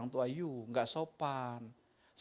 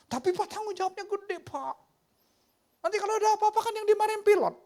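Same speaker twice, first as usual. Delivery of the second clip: high, fast pleading protest; whiny young son; 5 dB louder, faster than usual. Retold or imitated. Son protesting to his father about captain duty, high and rushed. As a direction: rush it out like a nervous kid.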